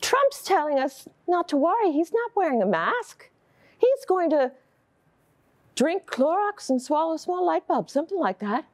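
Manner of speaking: high-pitched voice of a doubting husband; protesting husband. Acted. Voices husband parroting Trump.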